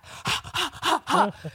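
big open-mouthed mock laugh; a hammed-up wide-mouth laugh; imitated wide-mouth laugh. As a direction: imitate a huge gaping laugh. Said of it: "biggest wide mouth laugh".

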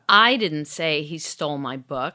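"I" punched at the start; "I" stressed, rest even. Shown as a demonstration. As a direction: hit the word "I". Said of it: "stress "I".

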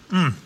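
appreciative mm while eating; savoring delicious food. Real, not acted. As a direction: hum with pleasure over the bite. Tasting goulash.

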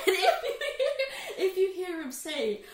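talking through giggles; can barely get the words out for laughing. Real, not acted.

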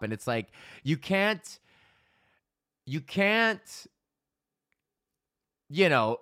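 ordinary talk, nothing marked

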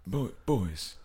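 a failed stab at a gruff Robert De Niro; bad De Niro impression. Doing De Niro for friends, badly, botched impression. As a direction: try De Niro and miss it. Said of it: "That was awful".

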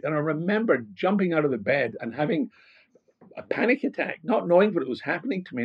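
ordinary talk

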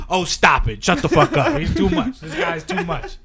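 high, loud laughing protest; mock modest protest. Jack waving off his partner's praise, high and loud. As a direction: brush off the praise, loudly flattered. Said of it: "And Jack's like".

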